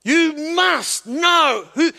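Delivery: slow, loud, stress on must; emphatic shout. Acted. Preacher shouting the point at the congregation, emphatic. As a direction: shout it out to the people. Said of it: "This is emphatic".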